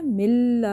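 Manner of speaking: مل held on a doubled l, no nasal hum; merged laam. Shown as a demonstration. Teacher shows the merging, مل with a clean held laam. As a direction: lean on the l of مل without ghunna. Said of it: "اس طرح کی آواز آ رہی ہے".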